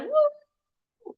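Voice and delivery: high-pitched excited whoop; the emotional monkey cheering, high and thrilled; excited woo. Retold or imitated.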